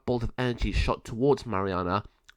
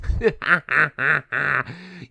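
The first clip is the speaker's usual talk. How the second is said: high, loud villain cackle; evil cackle; 4 semitones higher, 5 dB louder than usual. Acted. Malvado cackles at Mariana, loud. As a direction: cackle in triumph.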